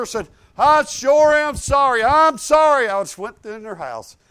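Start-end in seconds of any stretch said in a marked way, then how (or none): 0.6-2.9 earnest loud apology. Retold or imitated. Retold apology to old woman.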